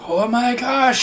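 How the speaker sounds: high, delighted gasp of shock; surprised shriek. Acted. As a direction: squeal it in shock. Chrissy excited shriek.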